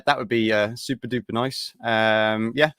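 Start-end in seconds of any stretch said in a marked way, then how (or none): none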